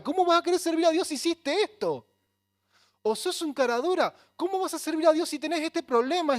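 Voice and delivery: loud, high mocking accuser, pushing hard; devil's taunting voice. Pastor voicing the devil to his listeners, loud high mocking taunt. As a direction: sneer and accuse like the devil himself. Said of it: "el diablo te dice".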